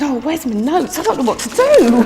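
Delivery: helpless comic dismay; mock panic. Teacher facing two crying boys forgets her training, mock panicked.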